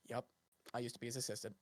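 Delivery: very soft, low mumble; quiet, resigned admission; muted aside. Acted. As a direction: say it low and under the breath. Character's rueful aside, acted.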